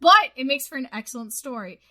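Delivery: usual speaking voice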